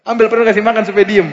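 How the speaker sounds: laughing. Real, not acted. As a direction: chuckle out the advice. Laughing at his own joke. (sambil tertawa)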